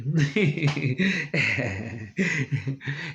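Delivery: laughing. Real, not acted. Laughs at quote.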